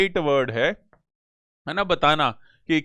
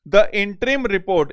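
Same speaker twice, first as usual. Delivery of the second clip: reads the sentence out very slowly; unhurried reading, word by word; slow dictation reading; 4 dB louder, much slower than usual. Shown as a demonstration. Teacher reading an exam question aloud, very slow.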